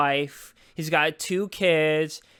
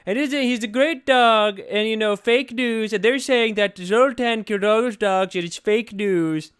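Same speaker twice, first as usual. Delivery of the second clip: higher-pitched, emphatic political impression; 3 semitones higher, 3 dB louder than usual. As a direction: talk it up in a loud, boastful impression. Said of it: "he's doing a [name] face".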